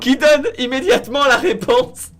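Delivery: high, loud, slow teasing; mocking. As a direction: drag it out to mock him. Se moque de la question ratée. (moquerie haute et traînante)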